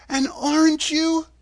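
put-on a girl addressing a wizard; young heroine Dorothy. A narrator's reading. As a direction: lift the voice into a girl's earnest demand. Dorothy questions the man.

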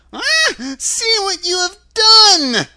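very high, loud, drawn-out shriek; shrieking witch in panic; witch's scream. A narrator's reading. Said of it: "she screamed".

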